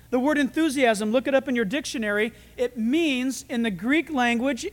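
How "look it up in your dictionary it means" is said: usual speaking voice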